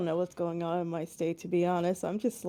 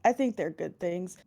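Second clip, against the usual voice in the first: ordinary talk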